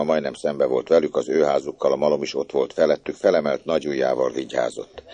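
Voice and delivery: usual speaking voice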